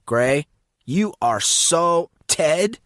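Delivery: slow, drawn-out accusation; scowling, deliberate reproach; Casey, scolding. Acted. Casey scolds Monson after the prank, slow and scowling.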